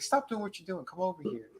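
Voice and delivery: soft, lightly voiced come-on; mock flirtatious. Recapper as possessed woman luring a man over, mock flirtatious. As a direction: coax quietly, imitating a flirt. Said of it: "she's kinda being extra flirtatious".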